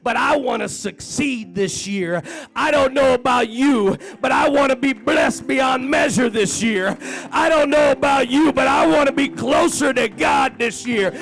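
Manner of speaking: high, loud preacher's cry; full-force preaching. Acted. Preacher crying out to the church about his hopes. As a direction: preach it out, building each line. Preaching climax, louder higher.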